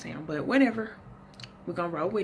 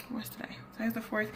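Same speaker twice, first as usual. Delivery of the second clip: whispered aside; 5 semitones higher, 7 dB quieter, faster than usual. Real, not acted. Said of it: "asking herself the date".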